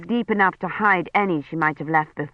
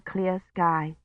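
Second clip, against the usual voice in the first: ordinary delivery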